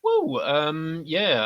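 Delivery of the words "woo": short high-pitched whoop; high woo. Real, not acted. Last reviewer handed the floor, high playful whoop. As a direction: let out a quick high woo. Taking his turn to speak.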